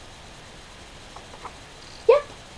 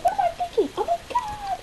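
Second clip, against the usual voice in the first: shrieking jackpot winner; 10 semitones higher than usual. Acted. Panicked high shrieks.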